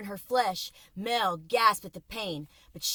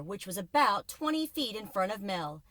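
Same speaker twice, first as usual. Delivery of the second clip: plain reading voice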